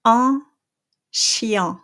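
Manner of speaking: chien ending in the smiling nasal; French nasal in. Shown as a demonstration. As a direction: spread lips for chien. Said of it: "it's gonna be".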